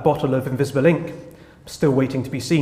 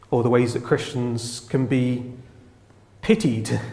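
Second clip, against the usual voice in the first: normal talking voice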